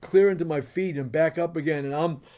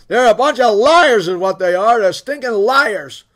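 loud, higher retold rant, slowing at the end; mad wife; 5 semitones higher, 11 dB louder than usual. Wife denouncing the church men to her husband, mad and loud. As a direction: hurl the accusation as an indignant wife. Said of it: "and she's mad".

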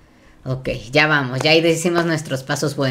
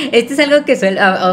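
laughing; 7 semitones higher, 4 dB louder than usual. Real, not acted. Nervous laugh while explaining.

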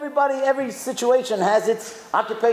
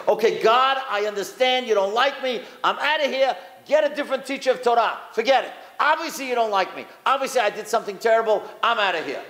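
higher, exasperated protest to God; fed-up quitting; 4 semitones higher than usual. Acted. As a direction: act out throwing in the towel. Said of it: "What would you say?".

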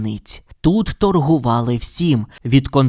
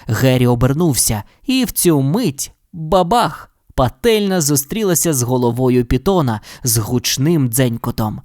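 usual speaking voice

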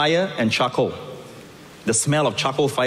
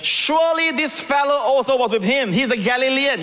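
high, confident accusation; 11 semitones higher than usual. Acted. Bystander affirming Peter's guilt.

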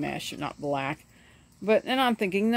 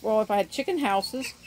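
usual speaking voice

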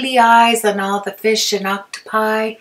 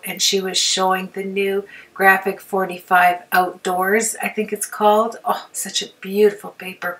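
usual speaking voice

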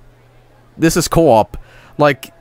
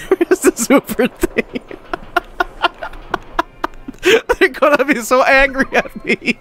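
giggling while speaking; laughing; 7 semitones higher than usual. Real, not acted. Guest building a wild coaster, laughing helplessly. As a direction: let the laughter spill into the words. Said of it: "I'm sorry I'm laughing so much".

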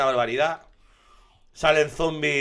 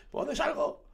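panicked squeak; 4 dB quieter than usual. Real, not acted. Trapped by horde, looking for exit.

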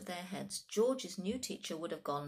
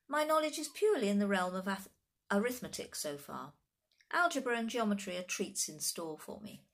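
put-on higher, emphatic child scholar; small know-it-all, pleased with the subject; precocious little boy; 3 semitones higher, 4 dB louder than usual. Voices precocious George.

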